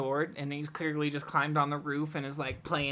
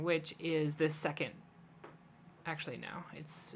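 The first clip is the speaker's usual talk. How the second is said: usual speaking voice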